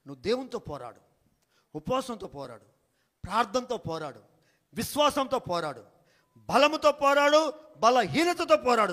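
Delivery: preaching that climbs from calm to loud; rising sermon cadence, each line stronger; building preaching. Acted. Pastor to congregation, building sermon rhythm.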